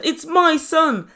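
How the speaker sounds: slow, loud and high; mock outraged protest. Imitating his mum claiming her son, loud mock protest. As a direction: stake the claim like an outraged mother.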